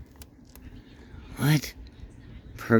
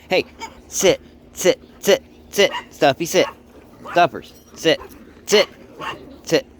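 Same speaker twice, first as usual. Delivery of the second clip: firm dog commands; 7 semitones higher, 4 dB louder than usual. Real, not acted.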